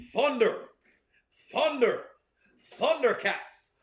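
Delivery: lightly mock heroic rallying call; playful rendition of a hero's chant; cartoon battle cry. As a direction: call out each word separately, rising then falling.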